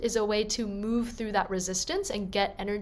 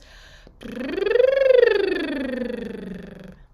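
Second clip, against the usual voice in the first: loud buzzing lip trill sliding up and down; 10 semitones higher, 8 dB louder than usual. Shown as a demonstration. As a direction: blow a rolling lip trill. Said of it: "So it's like this".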